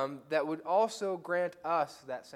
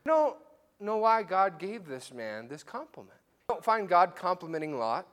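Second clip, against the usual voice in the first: ordinary talk, nothing marked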